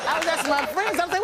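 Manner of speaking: normal talking voice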